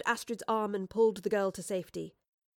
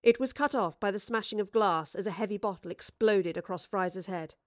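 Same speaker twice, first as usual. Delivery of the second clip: ordinary delivery, nothing marked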